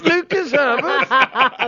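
high, slow hammed-up British accent; put-on British accent. Shown as a demonstration. Naming the golfer in a mock British accent. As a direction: stretch the name out like a British announcer. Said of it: "you're leaning Cockney".

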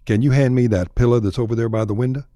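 a quick Texan line with "pilla"; Texan example. Shown as a demonstration. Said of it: "Say window and pilla".

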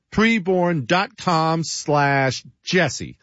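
slow, slightly lifted URL read; slow URL repeat. Shown as a demonstration. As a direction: repeat the address slowly so it sticks.

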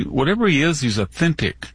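ordinary talk, nothing marked

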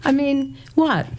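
high-pitched incredulous scoff; incredulous aside. Real, not acted. Woman mocking an expert's claim about women's voices, incredulous.

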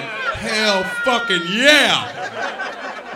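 mock victory roar; triumphant yell. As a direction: shout it like a proud hero, very slow. Boyfriend's drawn-out triumphant yell.

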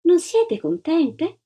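a hint of a condescending charity lady; sugary false smile. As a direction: coo it with an insincere smile. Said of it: "non era un sorriso simpatico".

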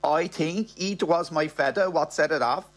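fake-innocent line in a half Spanish accent. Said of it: "in a half Spanish, half English accent goes".

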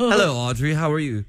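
put-on British greeting; amateur British accent. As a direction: open in a mock-British accent. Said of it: "Y'all have a British off right now".

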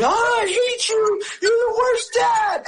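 very high, slow bratty whine; whiny daughter. Retold or imitated. The ten-year-old daughter whining at her dad after a no, in a high slow voice. Daughter refused, throws tantrum.

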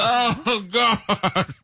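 worn-out neighbor's high-pitched lament; mock exasperation. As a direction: groan it high, half laughing. Acting out reaction to nightly howling.

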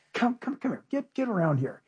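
very soft, affectionate coaxing; fatherly tenderness. Acted. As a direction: gather them in quietly like a loving father. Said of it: "he just melts with this fatherly heart".

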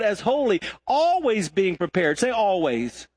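high, driving sermon delivery; impassioned preaching. Real, not acted. Preacher urging people to memorize God's word, impassioned. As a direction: preach scripture with fervor, leaning on key words.

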